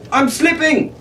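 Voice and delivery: put-on high frightened cry of a falling boy; a boy crying out as he slips into the pit; panicked cry. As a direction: yelp it out in fear. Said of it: "Tumon cried".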